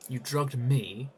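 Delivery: accusing. Acted. Accusing her of betrayal.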